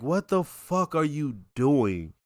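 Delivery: slow, quiet, incredulous; exasperated disbelief. Retold or imitated. Host scolding Chris for his dumb move, slow and disbelieving. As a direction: drag it out in disbelief. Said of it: "it's like".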